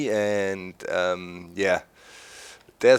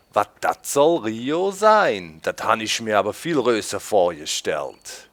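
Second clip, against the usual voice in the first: Rhineland dialect; 3 dB louder than usual. Shown as a demonstration. Quoting a movie in Rhineland dialect. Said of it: "that's a quote from a movie".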